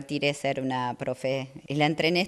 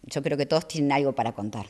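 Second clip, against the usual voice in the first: ordinary talk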